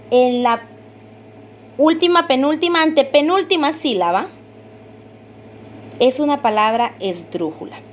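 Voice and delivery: ordinary delivery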